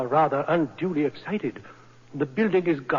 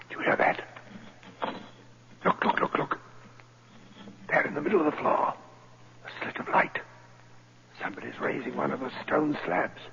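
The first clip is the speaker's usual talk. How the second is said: urgent hushed alarm; frightened whisper; 4 dB quieter than usual. Acted. Banker whispering in alarm at the light in the floor. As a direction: whisper it, scared of being heard.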